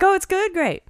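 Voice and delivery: high, fake-cheery reply; fake-cheery barista. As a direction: sound politely unconvinced. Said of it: "he's like".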